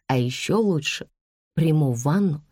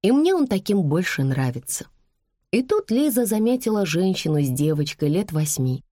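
ordinary delivery, nothing marked

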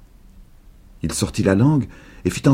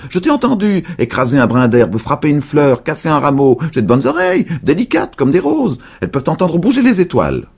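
high, louder boast; boasting aloud; 10 semitones higher, 5 dB louder than usual. A narrator's reading. Old woman taunting invisible boy, high loud boast. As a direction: brag about her sharp ears.

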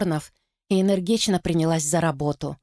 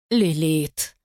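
sighing. Acted. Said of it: "вздохнула она".

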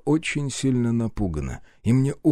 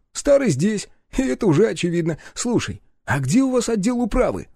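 brash sidekick; 11 semitones higher than usual. Acted. Partner questions the girl about the police, brash. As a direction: voice an excitable sidekick taking over.

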